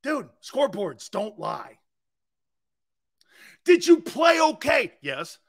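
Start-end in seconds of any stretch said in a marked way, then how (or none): 3.6-4.9 loud pointed question; shouted question. Real, not acted. Quizzing the player, loud pointed question. Rhetorical Q&A to himself. 5.0-5.4 low, soft yes; quiet answer. Real, not acted. Answering his own question, low soft yes.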